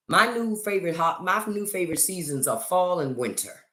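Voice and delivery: ordinary talk, nothing marked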